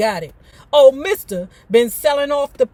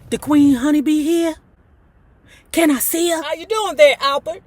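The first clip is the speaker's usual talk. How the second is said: lightly voiced Tobias; 6 semitones higher than usual. Tobias arriving, voiced by reader.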